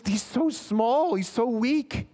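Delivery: high, slow pitying voice; gentle compassionate intercession; tender pleading. Acted. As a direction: plead for the helpless. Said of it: "No, he says".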